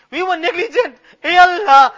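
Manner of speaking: a real catch of tears in the voice; voice breaking. Real, not acted. Imam admitting the community's failings, voice breaking. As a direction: confess it as the tears come. Confessing negligence in dua.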